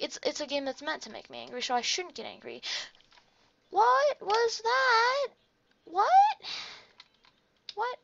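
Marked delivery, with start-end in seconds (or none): 3.7-8.0 high, slow drawn-out question; slow disbelief. Real, not acted. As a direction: stretch it out in disbelief.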